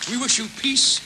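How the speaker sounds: formal farewell. Real, not acted. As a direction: wish the audience well with a flourish. Closing wishes to audience.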